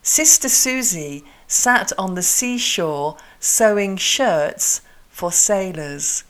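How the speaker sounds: slow, precise S in "Sister Susie"; tongue-twister pace slowed right down on "sat on the seashore"; articulation drill. Shown as a demonstration. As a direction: spread out "shirts for sailors", every sibilant distinct. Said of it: "moving the mouth a lot".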